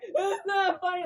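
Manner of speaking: high, whiny objection; mock upset protest. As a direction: protest in a hammed-up wounded wail. Retold protest at being laughed at.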